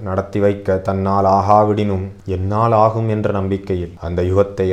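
ordinary delivery

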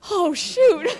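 high, loud startled "oh, shoot"; dismayed oops. Retold or imitated. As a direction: blurt it out like a sudden panic. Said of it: "I was like".

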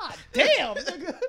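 mock excited outburst. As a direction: react hyped at the diss. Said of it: "I'm like".

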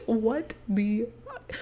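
slow, hushed bafflement; stunned disbelief. Retold or imitated. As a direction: draw it out softly, word by word. Retold disbelief at his excuse.

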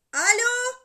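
high, very loud hello; boy yelling into the receiver; shouting. A narrator's reading. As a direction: bellow it down the phone. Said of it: "diye yine bağırmaya başladı Alcest".

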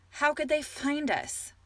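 high, slow, urgent demand. A narrator's reading. Alexandria confronts the wizard about the army, demanding. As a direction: press him for an answer. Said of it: "Alexandria demanded".

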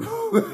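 loud, very high laugh; burst of laughter. Real, not acted. Man laughing after his blunt joke, loud high laugh. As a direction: crack up at your own line.

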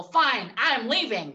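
high, loud huffy exit line; mock storming off. Mocking husbands who storm off, high and huffy. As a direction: ham up a huffy walkout. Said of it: "they play out the whole".

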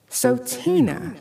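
high, slow, menacing doll voice; spat threateningly. A narrator's reading. Dummy turns on the girl, slow and menacing. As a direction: draw out her name like a threat. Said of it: "the dummy spat".

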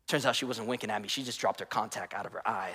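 low, soft deflated punchline; anticlimax, falling flat. Acted. Friend ending a wink story, quietly deflated. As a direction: let the mock suspense drop away quietly. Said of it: "And the story falls flat".

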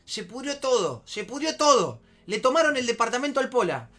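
high, loud panicked report; alarma imitada. Retold or imitated. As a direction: break bad news like the frantic friend. Ingeniero da la noticia alarmado. (imitando al amigo alarmado)

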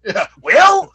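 very high, loud wrestler's cry; mock promo yell. Host playing the tag partner answering, yelling. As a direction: belt out the word like a promo catchphrase.

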